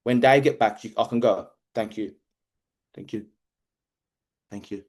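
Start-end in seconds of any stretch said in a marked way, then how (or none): 1.7-4.8 small, low thank-yous fading away; mock-humble, ever softer; meek thanks. Worker meekly thanking the boss for a toilet break, fading.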